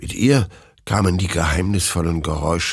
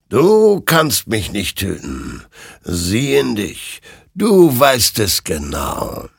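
slow, louder, knowing warning; ghostly rider; 4 semitones higher, 4 dB louder, slower than usual. Acted. Rider tells Hektor he cannot kill him, slow and ominous. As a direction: lean in and let each word weigh.

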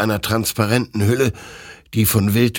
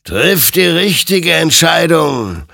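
loud, high, drawn-out warning; stern admonition; 10 semitones higher, 7 dB louder, slower than usual. A narrator's reading. The creature warns the soldier to choose rightly, loud and admonishing. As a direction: admonish with booming, stretched words. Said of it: "ermahnte ihn sein Gegenüber".

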